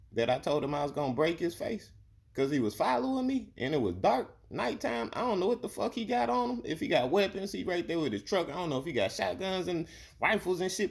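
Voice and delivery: high, insistent rant; heated protest. Real, not acted. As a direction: argue it hotly, voice climbing. Heated defence.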